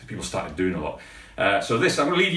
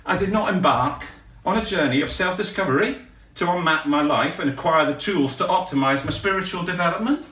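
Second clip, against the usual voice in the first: ordinary talk, nothing marked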